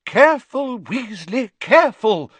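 high, loud warning; teacher's high-pitched cry of alarm. A narrator's reading. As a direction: call out in alarm to a pupil. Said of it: "cried Professor Sprout".

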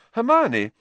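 high, fast disbelief at a friend; astonished, rushing the words; astounded. Acted. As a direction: blurt it out, baffled and quick.

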